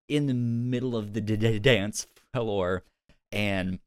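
ordinary talk, nothing marked

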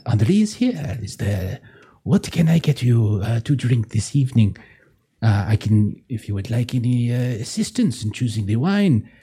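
gracious waiter in a put-on French accent; badly faked French, politely offering service. Henri offering guests a drink, hammy French accent. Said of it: "apparently a terrible French accent".

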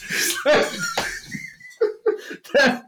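big high-pitched burst of laughter; real laugh. Real, not acted. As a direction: crack up laughing helplessly. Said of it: "both these boys can't even laugh".

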